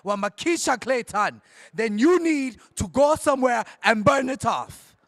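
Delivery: hammed-up Jamaican accent, punchy name calls; bad Jamaican accent. Hyping Shelly to bring the fire, bad Jamaican accent. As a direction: put on a badly faked Jamaican accent. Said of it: "That was the most worst Jamaican accent".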